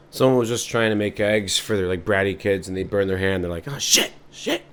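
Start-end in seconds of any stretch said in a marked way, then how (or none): 3.7-4.6 under-the-breath cursing; hissed pain. Acted. Parent burning hand at the stove, hushed curse. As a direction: swear without voice at the burn.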